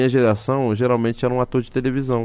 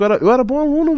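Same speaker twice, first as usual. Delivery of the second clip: high emphatic protest; 12 semitones higher, 6 dB louder, faster than usual. Real, not acted. Emphatic protest, pitch jump.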